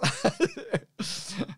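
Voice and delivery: short high laugh; high real laugh. Real, not acted. Joke about nuts.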